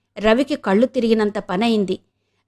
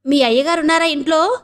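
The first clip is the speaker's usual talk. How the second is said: higher, louder question, rising on ఇంట్లో; asked loudly; 5 semitones higher than usual. A narrator's reading. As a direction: call it out so a deaf maid hears. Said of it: "బిగ్గరగా అడిగాడు". (బిగ్గరగా అడగడం)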